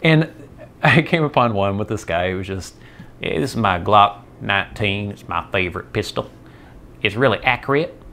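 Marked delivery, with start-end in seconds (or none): 3.2-8.0 put-on Southern accent. Man showing off his favorite pistol on YouTube, mock Southern accent. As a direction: do a hammed-up Southern gun guy. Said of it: "he talked like that".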